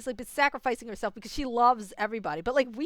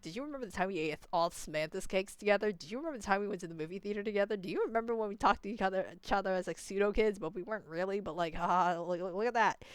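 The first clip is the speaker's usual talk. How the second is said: quicker, softer mocking recital; mock reminiscing; 3 semitones lower, 8 dB quieter than usual. Retelling shared memories as the characters, mock reminiscing. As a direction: rattle it off low-key, lightly mocking.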